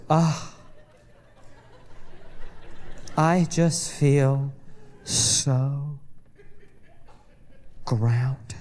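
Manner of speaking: low, slow, blissed-out sigh of a voice; dreamy wife. Retold or imitated. Wife leaving the crystal shop, slow blissed-out sigh. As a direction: drift through it like you've just found inner peace. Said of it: "she just goes".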